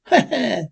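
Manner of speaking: a short mock "ha ha" for the crowd; crowd laughing. Townsfolk laughing at the running-for-mayor joke, mock laugh. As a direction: laugh like the whole crowd at once. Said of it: "crowd laughs".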